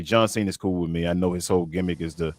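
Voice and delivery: ordinary talk, nothing marked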